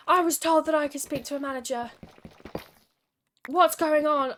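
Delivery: loud, demanding customer yelling for the manager; mock angry shout. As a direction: throw it across the room like an irate customer. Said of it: "shouting at the bartender".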